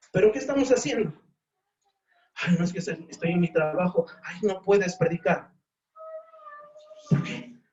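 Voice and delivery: high, pressing preacher's challenge; fervent preaching. Real, not acted. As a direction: demand an answer from the flock. Preaching climax, high pitch. (reto fervoroso de predicador)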